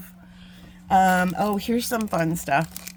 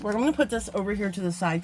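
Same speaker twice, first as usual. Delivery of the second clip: ordinary talk, nothing marked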